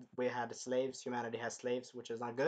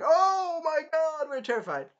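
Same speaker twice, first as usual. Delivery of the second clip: mock terror; 11 semitones higher, 6 dB louder than usual. The crowd faking terror, sarcastic and high. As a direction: ham up fake fear.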